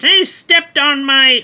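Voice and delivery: high, loud, slow mock shout; mock indignant woman. Woman yelling at the alien that trampled her building, high mock shout. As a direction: ham up an outraged lady yelling at a giant. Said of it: "a woman steps up and says".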